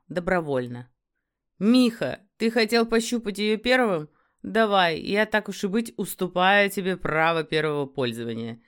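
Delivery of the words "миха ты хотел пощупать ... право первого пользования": louder, higher taunting offer; mocking werewolf. A narrator's reading. A werewolf offering his friend first turn with the captive, loud mocking. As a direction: toss it out loudly like a generous favour.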